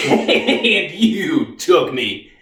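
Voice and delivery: slow words broken by a laugh; bitter laughing accusation. Acted. Reeves repeats his charge at the Army, bitterly laughing and slow. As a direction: laugh bitterly through the blame.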